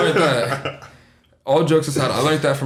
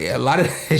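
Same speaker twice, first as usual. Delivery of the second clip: ordinary talk, nothing marked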